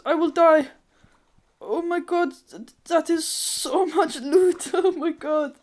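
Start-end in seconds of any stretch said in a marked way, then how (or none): none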